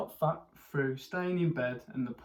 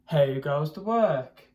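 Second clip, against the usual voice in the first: loud, slow call from bed; farmer calls; 9 dB louder, slower than usual. A narrator's reading. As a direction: bellow it, startled awake. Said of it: "he called".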